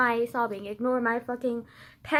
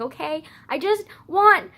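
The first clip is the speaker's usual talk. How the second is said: ordinary delivery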